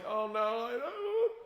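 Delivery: high-pitched hammed-up whimper; mock scared. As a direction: whimper in comic dread, pitch climbing. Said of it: "I'm scared".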